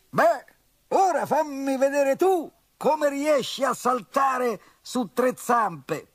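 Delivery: high, loud indignant rhino. A narrator's reading. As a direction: throw the challenge back at him.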